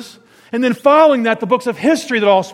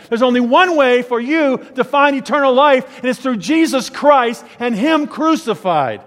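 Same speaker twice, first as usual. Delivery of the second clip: bold preaching; 6 semitones higher, 4 dB louder than usual. Acted. The bold answer he would give.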